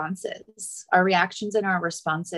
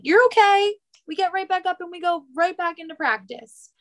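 put-on peppy reassurance, high-pitched and hurried; brisk encouragement; 10 semitones higher, faster than usual. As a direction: imitate cheering a child back onto their feet. Acted coach or parent reassuring child.

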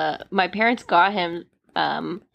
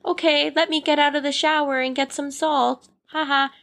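mock calm giggle; 6 semitones higher than usual. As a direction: play it dainty and unbothered. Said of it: "be like".

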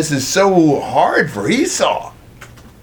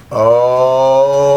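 long, louder groaned "oh"; drawn-out oh of dismay, acted; imagined dismay; 2 semitones lower, 5 dB louder than usual. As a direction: imitate a father's sinking realization. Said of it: "I can imagine him".

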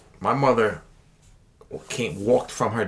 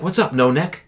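mocking teacher; 8 semitones higher, 6 dB louder than usual. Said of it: "And he goes".

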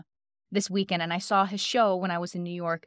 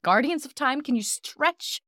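higher plea, drawn out slowly at the end; mock-epic praying; 4 semitones higher, slower than usual. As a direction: plead with the heavens, stretching it out. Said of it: "I started praying".

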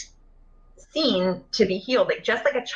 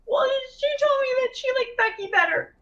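put-on much higher little girl voice; hurt child. A little girl tells mom about Becky, high and sulky. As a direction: tell it like a sulky kid.

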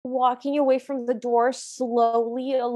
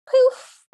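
loud, higher puff of a magic poof; mock poof; 10 semitones higher, 7 dB louder than usual. Retelling the fairy godmother's arrival with a loud higher mock poof. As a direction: pop it out like a magic burst.

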